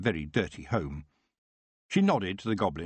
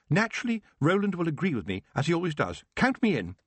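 put-on high, prim young lady voice; a noblewoman refusing with shocked dignity; young Baroness; 9 semitones higher, 3 dB louder than usual. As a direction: protest like a proud young noblewoman. Baroness commits.

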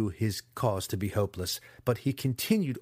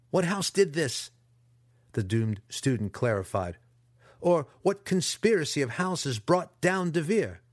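desperate pleading; 6 semitones higher, 4 dB louder than usual. A narrator's reading. Captive pleads for answer.

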